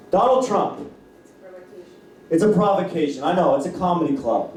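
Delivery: usual speaking voice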